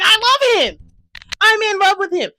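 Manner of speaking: high, loud fangirl squeal; lovestruck outburst. Retold or imitated. Fan reliving first sight of a crush, lovestruck shout. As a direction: cry it out, hopelessly smitten.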